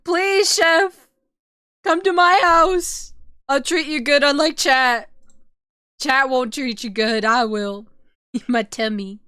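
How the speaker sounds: high, loud begging whine; pleading. Retold or imitated. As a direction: beg the chef like a lovesick fan.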